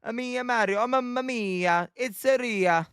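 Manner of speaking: playful sing-song mock-Italian voice; Mario impression. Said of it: "The only one I can do".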